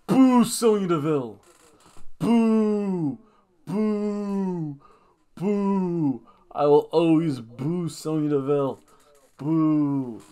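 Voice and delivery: long drawn-out boos; playful heel-hating boo; mock booing. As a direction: jeer like a heckling wrestling fan. Jeering a wrestling figure like a crowd.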